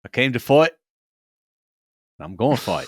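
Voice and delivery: Australian "fight" said "foyt", hammed up; a mock Aussie fighter boasting; bad Australian accent. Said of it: "no more bad Australian accents".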